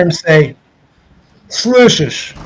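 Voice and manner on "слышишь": imitating a hungover plumber on the phone; higher-pitched mock hungover reply. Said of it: "awful hungover voice picks up".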